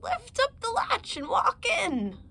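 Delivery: a wolf faking a frail grandmother; wolf disguised as granny. Acted. Wolf in grandmother's bed invites the girl in with a faked granny voice. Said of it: "disguised his voice and said".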